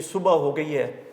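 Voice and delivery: everyday صبح with an open final "a"; common colloquial pronunciation. Shown as a demonstration. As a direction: say صبح as "su-ba", two syllables. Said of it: "صبح لفظ استعمال معروف ہے".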